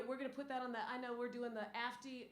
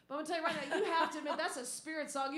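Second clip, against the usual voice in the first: emphatic; 5 semitones higher, 7 dB louder, faster than usual. Real, not acted. Insists to the audience.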